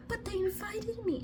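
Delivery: small, high, soft mock-innocent voice; naive heroine imitation. Heroine excusing why she stays, high mock plea.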